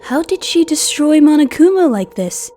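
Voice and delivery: high, loud astonished question; shocked disbelief. Acted. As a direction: blurt it out in stunned amazement. Reacting to destroyed Monokuma.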